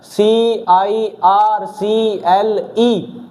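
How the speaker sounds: सीआईआरसीएलई spelled very slowly letter by letter; spelling out. Shown as a demonstration. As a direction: dictate सीआईआरसीएलई one letter at a time. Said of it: "circle होता है ना".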